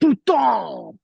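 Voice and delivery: put-on high, loud French-accented swear; mock French outburst. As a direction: yell it as a hammed-up French curse. Playing along with French swearing joke.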